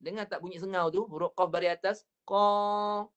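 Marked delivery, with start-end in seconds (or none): 2.2-3.0 Qa pushed through the nose; nasal Qa as the error. Shown as a demonstration. Teacher repeats the nasal Qa error. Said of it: "bunyi dia sengau dah masuk hidung lah".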